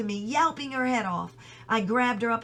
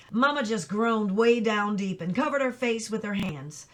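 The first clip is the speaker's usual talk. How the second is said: plain reading voice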